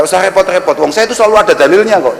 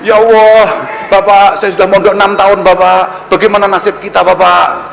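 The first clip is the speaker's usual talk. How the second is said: whining santri. Retold or imitated. Preacher voicing a santri begging about marriage, whiny plea. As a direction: plead like a desperate student.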